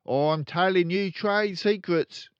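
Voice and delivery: loud, high, brash dealer father; boastful crooked dad. Acted. Father bragging to his kids, loud and brash. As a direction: brag like a swaggering car salesman.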